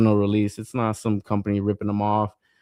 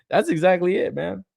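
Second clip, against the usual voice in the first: normal talking voice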